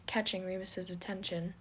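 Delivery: usual speaking voice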